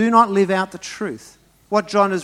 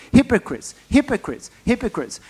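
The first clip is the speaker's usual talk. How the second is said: angry Jesus; 6 semitones higher than usual. Acted. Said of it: "read angry Jesus".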